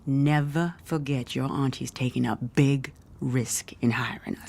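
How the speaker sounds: low whispered caution; hushed warning. Acted. Mother hushing her daughter about the aunt's risk, whispered. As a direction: whisper it so no one overhears.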